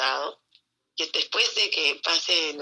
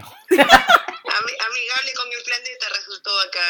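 laughing tease; 6 semitones higher, 6 dB louder than usual. Real, not acted. Teasing husband while laughing.

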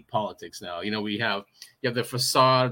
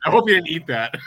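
laughing; 6 semitones higher, 7 dB louder than usual. Retold or imitated. Quoting wife, laughing.

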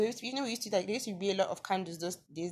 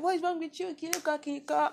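higher-pitched, lightly voiced scolding auntie; annoyed auntie; 8 semitones higher, faster than usual. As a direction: imitate an exasperated auntie nagging a child. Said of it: "My auntie used to be like".

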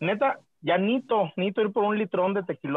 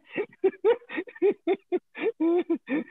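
high laugh; 9 semitones higher than usual. Real, not acted. Big laugh.

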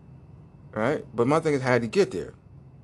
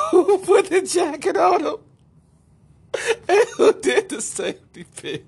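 high, loud squeal of disbelief; can't believe it, shrieking with amusement; laughing incredulity; 5 dB louder than usual. Real, not acted. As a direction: squeal the question through laughter. Incredulous ranting about the story.

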